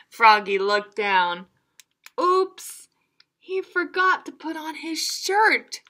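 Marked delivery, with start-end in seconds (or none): 2.2-5.8 higher surprised oops; a hint of a startled frog. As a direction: caught out again. Froggy caught again.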